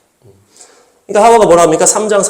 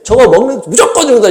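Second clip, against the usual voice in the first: God's unyielding warning, raised; decisive warning; 5 semitones higher than usual. Shown as a demonstration. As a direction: stress 무조건, absolutely certain. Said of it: "이렇게 딱 해야 되는데".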